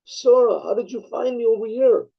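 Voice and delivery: high-pitched calling out, as a quoted shout; retold yell. Card player calls to Sora from the bar's back, retold yell. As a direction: retell a startled yell across a bar. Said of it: "yells out".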